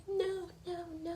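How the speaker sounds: high, quick repeated no; firm refusal. Retold or imitated. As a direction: turn him down flatly. Rory refusing Jess.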